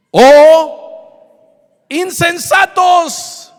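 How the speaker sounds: high, loud, drawn-out shout; sudden booming reproach, stretched long; thundering rebuke. Acted. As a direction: cry out in stern rebuke. Acts Jesus's rebuke from verse.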